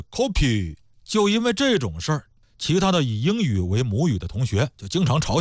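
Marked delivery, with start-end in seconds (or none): 0.0-0.7 coffee said in a mock Korean accent with a P sound, as kopi, falling; mock Korean English, coffee as kopi. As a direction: imitate a Korean speaker turning the f of coffee into p. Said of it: "韩国人一说就是coffee".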